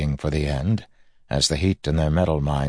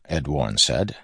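ordinary delivery, nothing marked

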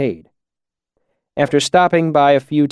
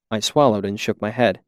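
ordinary delivery, nothing marked

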